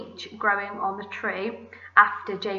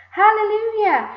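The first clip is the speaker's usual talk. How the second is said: very high, loud whoop; jubilant shout; 12 semitones higher, 8 dB louder than usual. A narrator's reading. Aunt celebrating the giant peach, jubilant shout. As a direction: shout it in triumph. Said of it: "Aunt Spiker shouted".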